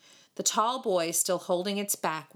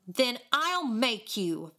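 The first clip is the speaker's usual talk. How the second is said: slow, higher-pitched threat; determined vow; 5 semitones higher, slower than usual. A narrator's reading. David threatening the boys for the kitten, vowing. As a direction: vow it slowly, with heat. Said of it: "vowed David".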